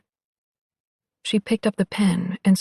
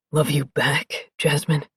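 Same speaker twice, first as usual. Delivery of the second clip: deep male voice; 5 semitones lower, 3 dB quieter than usual. Acted. Sarge voiced low.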